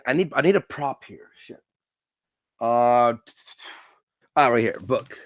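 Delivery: ordinary talk, nothing marked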